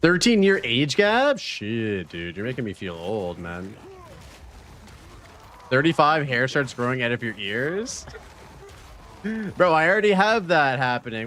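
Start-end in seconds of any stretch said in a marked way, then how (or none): 0.0-1.4 surprised. Real, not acted. Chat reveals an age gap. 9.2-11.3 laughing. Real, not acted. Laughs at ear-hair joke.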